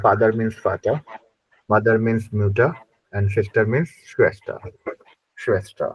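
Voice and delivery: very slow, word by word. Shown as a demonstration. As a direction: spell it out slowly for the class. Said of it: "slow comparison drill".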